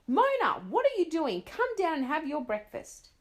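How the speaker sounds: loud, high call up the stairs; mother calling a late sleeper to breakfast; shouting mother. Acted. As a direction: yell for her to come down. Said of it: "her mother started shouting".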